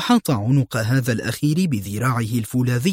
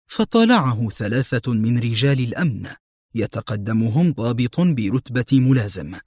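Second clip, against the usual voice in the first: usual speaking voice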